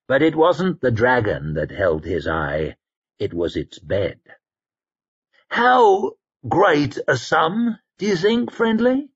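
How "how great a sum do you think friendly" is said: a dandy relishing the thought of treasure; greedy musing. Acted. Koska muses about the gold.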